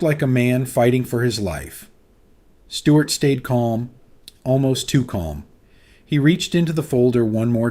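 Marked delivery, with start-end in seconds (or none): none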